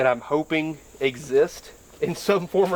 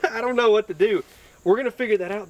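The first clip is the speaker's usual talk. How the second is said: high, laughing at himself; 8 semitones higher, faster than usual. Real, not acted. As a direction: laugh it off, sheepish. Laughs at own inexperience.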